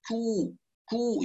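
"كو" with a thin, airy k, softly; light aspirated ك. Shown as a demonstration. Teacher corrects thickened k, light aspirated ك. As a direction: keep the k of "كو" light, with a puff of air. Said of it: "نحافظ على ترقيق الكاف وعلى همسها".

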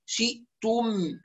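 "شئتم" slowly, pure i, catch, rounded u; correct vowels. Shown as a demonstration. Teacher models for students, correct vowels. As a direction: draw out "شئتم" with full vowels. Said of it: "هكذا".

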